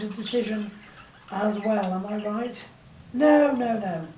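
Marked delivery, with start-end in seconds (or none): none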